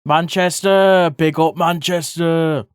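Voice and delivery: big up the city like a proud rally cry; hype shout-out. Real, not acted. Streamer shouts out Manchester to a viewer, slow loud hype.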